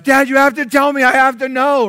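lightly played insistent boy; pleading kid. Pastor's sons begging Dad for the answer, mock pleading. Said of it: "they would go".

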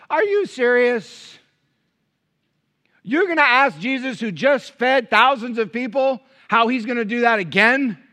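high, loud incredulous disciple; sarcastic disbelief. Retold or imitated. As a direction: scoff at the obvious question. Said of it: "sense the sarcasm in the text".

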